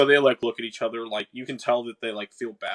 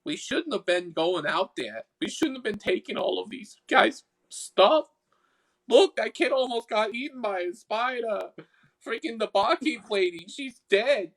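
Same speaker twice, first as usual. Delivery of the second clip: high, remorseful Boston sprite; high-pitched Boston accent, fretting; 9 semitones higher than usual. Acted. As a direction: scold your pal in a high Boston voice. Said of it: "I'm stuck in the Boston accent".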